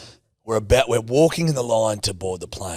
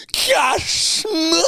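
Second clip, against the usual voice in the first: high, slow, hammed-up British aristocrat; British aristocratic accent; 3 dB louder than usual. Mimicking Louisa taking off her layer, British aristocratic accent. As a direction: stretch it out in a high upper-crust British voice. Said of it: "very distinct British aristocratic accent".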